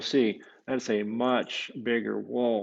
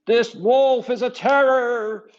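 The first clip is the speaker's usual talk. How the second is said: much higher, loud, slow scream of alarm; screaming Contessa; 12 semitones higher, 13 dB louder, slower than usual. Acted. Contessa screams to the town about the wolf, high and loud. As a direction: shriek it out as a frightened countess. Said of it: "screamed the Contessa".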